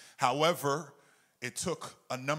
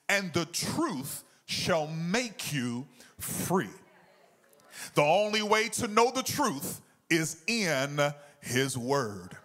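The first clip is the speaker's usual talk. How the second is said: rising, louder preaching cadence; 6 semitones higher, 6 dB louder, slower than usual. Acted. Pastor preaching the truth to his church, full fervour.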